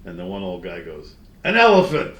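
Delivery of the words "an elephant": put-on louder, higher eager old man blurting a guess; old man's guess. Old guy on bench answering the old lady, loud eager guess. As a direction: blurt it out like a hopeful old geezer.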